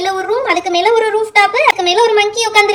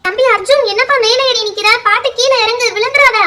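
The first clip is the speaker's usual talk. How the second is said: loud, high call up to the roof; aunt yelling up to the boy on the roof; worried calling out; 4 semitones higher, 5 dB louder than usual. Acted. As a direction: shout up to the boy, anxious he might fall.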